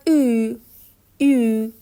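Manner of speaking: "او" said as a long German ü; German u-umlaut. Shown as a demonstration. Teacher models u-umlaut for learners, "او" as long ü. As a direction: say "او" as ü, an ee with rounded lips. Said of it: "او اوملات".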